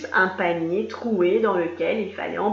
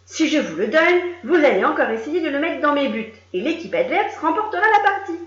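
higher, louder defiant mule; stubborn mule Lily; 6 semitones higher, 5 dB louder than usual. Acted. Lily arguing with the players, higher and defiant. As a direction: argue like a stubborn child. Lily defending her logic.

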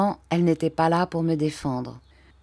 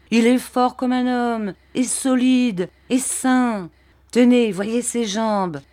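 higher, louder peasant boasting; salesman's pitch; 5 semitones higher, 3 dB louder than usual. A narrator's reading. Barberin boasting of the boy to the buyer, loud and high. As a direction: sell the boy like a cow at market.

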